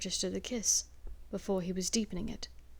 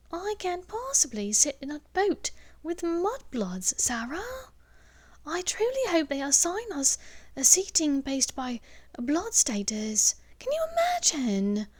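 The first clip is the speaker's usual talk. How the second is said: put-on high, whispery snob of a child; snooty little girl; 8 semitones higher than usual. Young pure-blood girl complaining to her friend, high and snooty. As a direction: sniff at the mudbloods like a spoiled child.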